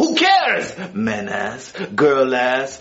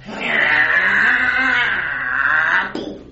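sharp shocked gasp; startled gasp. Acted. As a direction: gasp in surprise at the cell. Shocked gasp at new cellmates.

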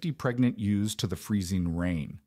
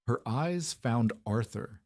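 plain reading voice

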